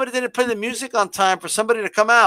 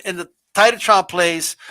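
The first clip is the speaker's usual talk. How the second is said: normal talking voice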